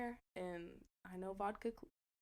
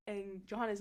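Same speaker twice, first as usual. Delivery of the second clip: usual speaking voice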